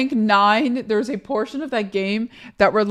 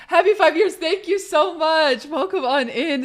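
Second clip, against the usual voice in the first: high-pitched gushing welcome, starting loud; excited thanks; 7 semitones higher, 3 dB louder than usual. Real, not acted. Streamer thanks a viewer and welcomes them in, excitedly. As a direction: gush a high, happy thank-you.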